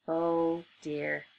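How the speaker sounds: ordinary delivery, nothing marked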